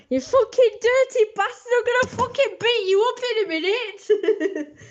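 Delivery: high hammed-up threat ending in laughter; mock husband tirade, pitched high; abusive husband. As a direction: rage at the wife, then crack up. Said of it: "That's him saying it to his wife".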